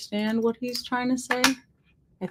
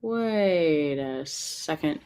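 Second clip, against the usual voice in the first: slow, low, stretched-out "wait"; low and slow, thinking aloud; drawn-out hesitation; 5 semitones lower, 4 dB quieter, much slower than usual. Real, not acted. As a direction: drag it out while working it out. Puzzled, drawn-out repeat to self.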